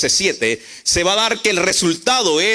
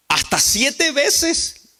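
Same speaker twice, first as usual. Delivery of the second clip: Peter asking; 7 semitones higher, much slower than usual. Acted. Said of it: "le dice Pedro".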